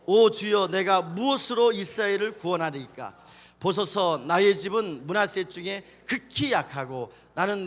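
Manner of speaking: very loud, measured scripture reading. Real, not acted. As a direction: project the verse to lead a group reading. (아주 크게 또박또박 성경 봉독)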